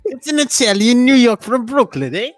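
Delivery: put-on a big comic Italian New York accent. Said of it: "That's Italian".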